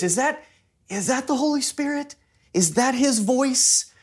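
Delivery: unsure question, lifted in pitch through HIS VOICE. Acted. Believers unsure whether the Holy Spirit is calling, questioning. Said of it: "question mark in our voice".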